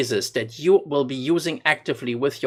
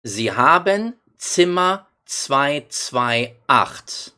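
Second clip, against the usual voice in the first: slow demo; much slower than usual. Shown as a demonstration. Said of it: "slow model phrase for learners".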